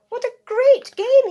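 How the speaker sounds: very high, loud delighted cry. Acted. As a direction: crow with delight. Dog delighted.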